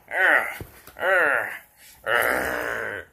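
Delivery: loud effortful urgh; tugging grunts; straining grunt. Real, not acted. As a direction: heave against the pull. Tug of war straining.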